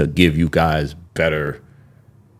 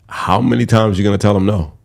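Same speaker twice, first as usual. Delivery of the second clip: normal talking voice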